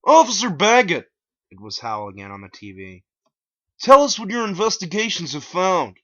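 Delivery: high, loud announcer voice; hammed-up news reporter. A narrator's reading. TV reporter on air.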